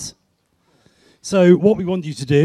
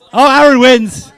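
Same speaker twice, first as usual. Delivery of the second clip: loud, raised-pitch announcement of a winner; excited winner call; 6 semitones higher, 8 dB louder than usual. Real, not acted.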